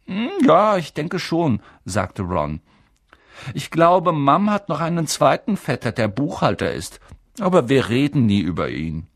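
put-on boy Ron chatting. Ron talks about his family.

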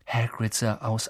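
plain reading voice

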